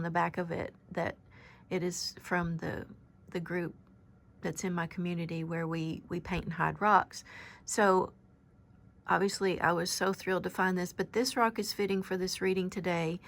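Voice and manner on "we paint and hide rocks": usual speaking voice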